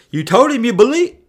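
high, loud incredulous outburst; freaking out. Retold or imitated. Friend reacting to the father's belief, freaking out. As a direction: imitate a man flabbergasted at what he hears. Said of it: "Barney starts freaking out".

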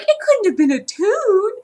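high-pitched mock denial; Roger impression. Mimicking Roger arguing it wasn't a toon, high mock protest. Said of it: "'Cause Roger kept being like".

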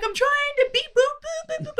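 very high beep-boop voice; hammed-up John fiddling with a device; mock fussing John. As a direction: squeak out the beeps like a busy fool. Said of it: "He's like".

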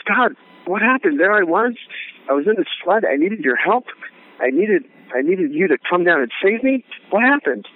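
hammed-up exasperated complaint, pitched high; furious rabbi. Drowned rabbi confronts God in heaven, mock-furious. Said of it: "and he's furious".

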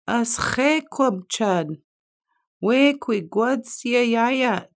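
high slow called-out question; hollering. A narrator's reading. Beaver hollering to the far shore. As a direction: call across the pond, drawn out.

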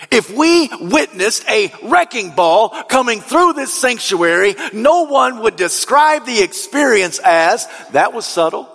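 high-pitched, driving sermon delivery; impassioned preaching. Real, not acted. Preacher likens Jesus's claim to a wrecking ball, impassioned. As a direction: preach it hard, swelling with each repeated phrase.

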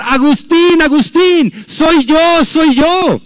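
high, loud calling of his name from a distance; woman calling out. Retold or imitated. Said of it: "una mujer le empezó a gritar". (mujer que lo llama a gritos, aguda y fuerte)

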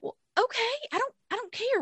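high-pitched retold protest; dismissive protest. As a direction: wave it off in a high, softish voice. Retold retort to the midwife.

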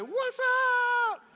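very high, drawn-out comic "what's up"; silly squealed greeting, stretched out; goofy greeting. Retold or imitated. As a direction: play the clueless buddy hollering hello. Newcomer greets man in hole.

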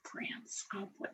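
whispered to herself; whispering. Real, not acted. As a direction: breathe it under your breath. Thinking aloud during cheese quiz.

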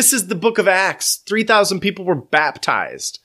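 high-pitched, drawn-out amazement; awestruck, slow and high; excited exclamation. Retold or imitated. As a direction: marvel at it, stretching the words high. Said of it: "And I was like".

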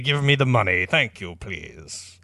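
greedy impostor. Acted. Impostor's claim trails down to asking.

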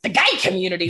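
mock announcement. Brain echoing dad's phrase every time, mock grand announcement. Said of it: "immediately my brain is like".

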